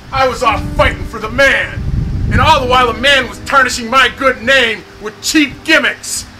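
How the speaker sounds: furious shouting rant; angry rant. Acted. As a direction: rage at the company, yelling. Enraged captain rant, shouting.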